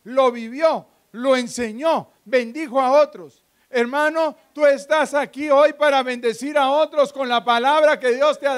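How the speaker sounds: driving, emphatic sermon delivery; forceful preaching. Real, not acted. Preacher urging believers to live the word, forceful preaching. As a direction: press each point home to the congregation.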